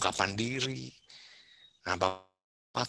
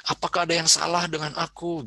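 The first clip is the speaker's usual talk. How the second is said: higher, pleading self-doubt; worried teen; 7 semitones higher than usual. Retold or imitated. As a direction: ask it like an insecure teen. Teen fretting over a pimple.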